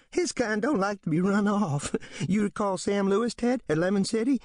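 high, gleeful voice with a laugh; cheered by it. Acted. Drunk Isaac Yeomans to Ted, gleeful. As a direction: relish the trouble. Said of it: "acts cheered by what the others dread".

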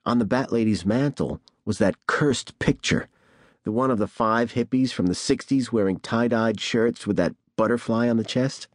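ordinary delivery

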